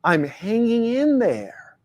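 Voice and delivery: worn-out, droning stock reply; a man mimicking a tired stock answer, slow and drawn out; slow weary drawl. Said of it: "culturally programmed responses".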